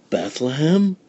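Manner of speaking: as if hearing it first time; surprised. Acted. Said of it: "said Befana".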